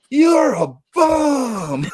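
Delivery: high, very drawn-out holler; mock heckle. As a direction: imitate heckling from a car, stretching each word. Retold friends heckling the player.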